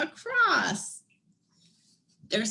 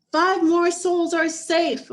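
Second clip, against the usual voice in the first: bright, loud, raised joyful call; joyful cry; 7 semitones higher, 6 dB louder than usual. A narrator's reading. Old Hattie at the steamship, crying out for joy. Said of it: "old Hattie cried".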